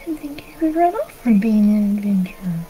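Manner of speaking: put-on soft, half-whispered boyish doubt; puzzled little boy. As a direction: say it like a small kid taken aback. Boy puzzled by runaway adventurer.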